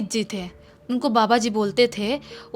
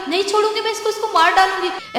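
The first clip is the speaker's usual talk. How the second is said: put-on very high, loud shrieking girl spirit; little girl's voice; 10 semitones higher, 5 dB louder than usual. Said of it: "ऐसे ऐसे बच्ची की आवाज़ में बोल रहा है". (पतली बच्ची की चीखती आवाज़)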